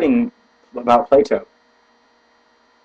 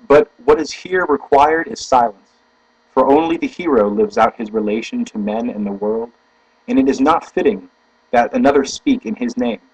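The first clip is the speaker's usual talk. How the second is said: deliberate, emphatic reading aloud; formal book reading; 2 semitones higher, 3 dB louder than usual. Acted. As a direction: read it out with weighty stresses.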